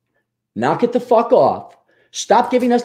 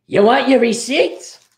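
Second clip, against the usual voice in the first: Mark Hamill Joker; 6 semitones higher, much slower than usual. Retold or imitated. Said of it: "I can't do a good Mark Hamill".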